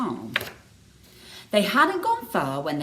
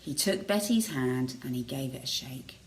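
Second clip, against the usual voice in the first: usual speaking voice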